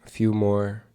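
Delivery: unhurried meditation guide, long pauses; measured, even meditation voice with spacious silences; guided meditation. Acted. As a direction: guide listeners gently into stillness, phrase by phrase.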